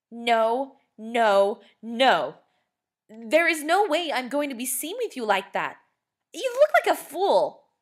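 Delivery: high, loud, appalled sister; horrified refusal. A narrator's reading. Sister rejecting her brother's costume, high loud horrified refusal. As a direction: shriek it out in disgust.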